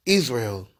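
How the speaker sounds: usual speaking voice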